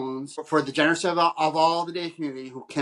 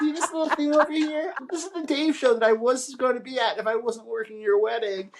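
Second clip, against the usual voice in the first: high, eager, show-and-tell voice; giddy, proudly showing off the phone; excited reply; 9 semitones higher than usual. Retold or imitated. Guest retells showing the bride the show on her phone, high and eager. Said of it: "I'm like".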